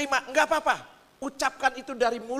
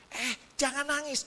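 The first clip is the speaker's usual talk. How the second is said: higher-pitched flustered retold plea; panik menenangkan; 6 semitones higher, 5 dB quieter than usual. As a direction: urge the crying child to stop, pitch raised. Second try as crying gets worse. (nada tinggi, kewalahan menenangkan anak)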